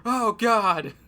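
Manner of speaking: frantic shouted confession; storming off shouting. Pretend. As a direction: yell it in desperate panic. S2 acts out Lucas returning.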